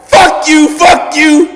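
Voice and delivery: imitated furious shout, very high and loud; so pissed. Pissed girlfriend cursing him before hanging up, high furious shouting. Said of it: "Simone gets so pissed".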